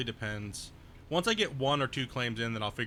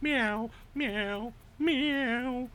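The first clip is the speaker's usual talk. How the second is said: high, slow whiny cat voice; voicing the cat; 11 semitones higher, much slower than usual. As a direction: play the needy cat begging for cuddles. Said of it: "She walked into the game room".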